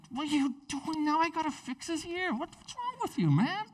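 very high-pitched exasperated scolding; hammed-up squeaky exasperation, not shouted; mock upset. Preacher imagining Jesus upset with Peter over the ear, mock exasperated. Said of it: "He could have been really upset".

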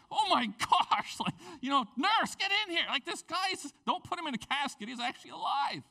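breathless high-pitched alarm; shocked excitement. Retold or imitated. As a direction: retell the friend's stunned cry that the dead man is alive.

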